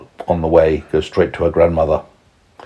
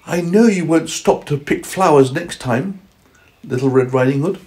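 higher-pitched mother voice; forgiving mother; 9 semitones higher than usual. Acted. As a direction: gently let the child off, pitched up. Mother's forgiving words, acted.

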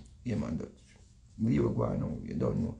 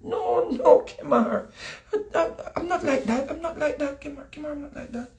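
higher, laughing coy protest; coy woman protesting; 6 semitones higher than usual. Retold or imitated. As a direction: play the girlfriend giggling, denying it. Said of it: "She said".

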